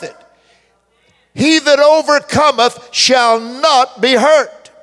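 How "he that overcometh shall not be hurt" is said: loud, slow, high proclamation; full-force preacher declaring scripture; preaching at full force. Acted. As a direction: proclaim the verse to the crowd. Preaching the verse.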